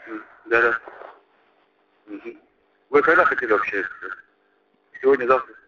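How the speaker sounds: ordinary talk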